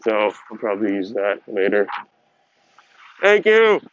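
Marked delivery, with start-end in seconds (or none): 3.2-3.8 high, loud sarcastic thank you; sarcastic shout. Real, not acted. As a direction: yell it back with mock gratitude. Shouting back at a catcaller.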